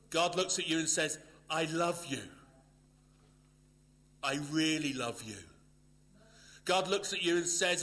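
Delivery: loud and high, rising with each "God looks at you"; building fervent preaching. Acted. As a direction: proclaim God's love to the congregation, swelling. Building God's message.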